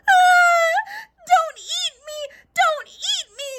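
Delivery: high, loud frightened cry; little girl begging the giant not to eat her; terrified scream. Acted. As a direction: shriek and plead in panic. Jackie grabbed by giant.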